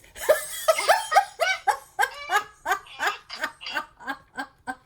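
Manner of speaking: long high laughing fit; giddy laugh. Real, not acted. Thrilled the coloring book arrives tomorrow, giddy laugh. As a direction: laugh out loud in delight.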